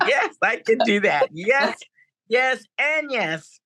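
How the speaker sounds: gleeful repeated agreement with a laugh; excited yes. Real, not acted. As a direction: savour every yes. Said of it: "Girl, yes".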